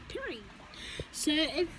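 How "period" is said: high, clipped sassy sign-off; sassy period. Real, not acted. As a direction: drop it like a final word. Sassy sign-off ending a point.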